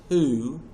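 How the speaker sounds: who drawn out and set apart; stress on who. Shown as a demonstration. Teacher naming the last economic question, stressing who. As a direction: land firmly on who. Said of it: "emphasis on the word who".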